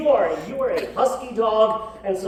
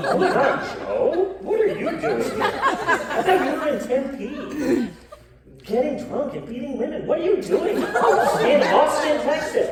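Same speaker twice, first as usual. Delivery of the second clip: higher, amazed greeting; mock surprise; 6 semitones higher than usual. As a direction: act shocked to see the brother. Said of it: "I'm like".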